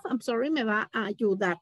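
usual speaking voice

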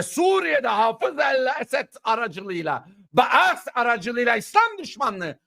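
slow, high, shouted sermon lines; fiery preaching. Acted. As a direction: hammer each phrase like a preacher at full force. (ateşli, yüksek sesle vaaz eder gibi)

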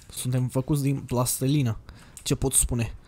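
usual speaking voice

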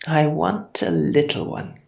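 ordinary delivery

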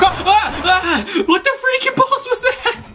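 high shriek, then a laughing yell; startled shriek. Real, not acted. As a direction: scream in shock, half laughing.

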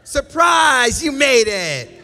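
high, loud playful shout; spring it on them like a party surprise; mock surprise shout. Pastor playing heaven's welcome to the congregation, a loud, high, playful shout.

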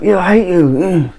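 high, slow sneering put-on voice; mock hater voice. Mocking an online troll, high slow sneer. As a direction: pitch it up and sneer it out slowly.

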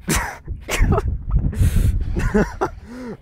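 long, high, airy laughter; giddy laugh. Real, not acted. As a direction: laugh helplessly, voice barely catching. Overjoyed at another big fish.

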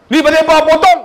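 loud, high angry outburst; scolding shout at a customer; angry counter clerk. Retold or imitated. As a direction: yell it like a cross official. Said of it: "Dia marah pakcik tu".

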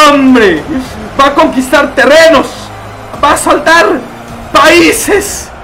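loud, high, rousing general's proclamation; epic war speech. Pretend. Streamer proclaiming Chuchito's army conquest, rousing shout. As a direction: whip up the troops for glory.

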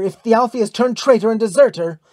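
high, loud, heated order; loud, accusing cry; commanding cry. A narrator's reading. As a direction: call out in angry suspicion. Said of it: "cried Thor's daughter".

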